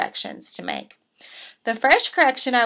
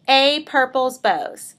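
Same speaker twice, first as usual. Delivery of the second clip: normal talking voice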